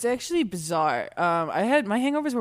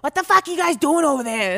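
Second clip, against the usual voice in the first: put-on rowdy drunk Boston accent, a bit higher and louder; mock Boston monster yelling across the bar; 7 semitones higher, 5 dB louder than usual. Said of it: "I'm a Boston monster".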